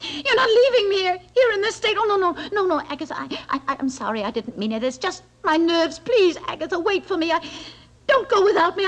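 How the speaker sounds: cling to her with your voice; frantic begging. Acted. Begging friend not to leave.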